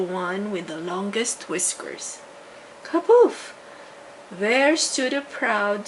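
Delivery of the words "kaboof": high, loud burst of a magic pop; magic kaboof. A narrator's reading. Fairy turning the rat into a coachman, loud magical pop. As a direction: pop it out like a spell going off.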